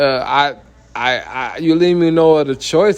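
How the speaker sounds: ordinary delivery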